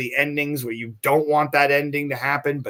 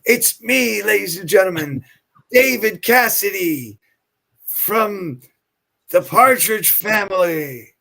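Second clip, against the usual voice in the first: loud, higher showman intro; grand announcement; 5 semitones higher, 5 dB louder than usual. Retold or imitated. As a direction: present himself like a star. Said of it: "David opens the door and goes".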